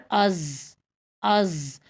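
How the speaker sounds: از ending on a held heavy ض buzz; sakin Daad. Shown as a demonstration. Teacher tests the articulation point, از with a sustained final buzz. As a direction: open از, then hold the emphatic consonant vowelless. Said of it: "یا ساکن کر کے دیکھ لیں".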